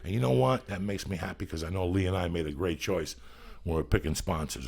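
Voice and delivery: usual speaking voice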